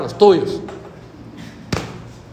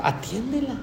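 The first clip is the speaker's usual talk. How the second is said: ruego suave y agudo, casi susurrado; súplica; 5 semitones higher, 8 dB quieter than usual. Shown as a demonstration. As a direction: suplica con voz baja y aguda. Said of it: "es una súplica". (súplica suave y aguda)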